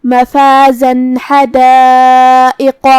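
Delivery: مفازا حدائق chanted slowly, the nun of the tanween said clearly before حدائق with no nasal hum; slow chanted link with a clear nun; clear tanween joining. Shown as a demonstration. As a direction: sound the nun plainly into حدائق. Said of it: "بغیر غنہ سے یہ حکم کو ادا کریں گے".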